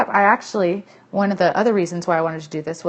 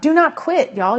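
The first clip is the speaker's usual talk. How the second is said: emphatic; 9 semitones higher, 5 dB louder, slower than usual. Real, not acted. Coach pleading with her team not to quit, loud and emphatic. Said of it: "passionate about".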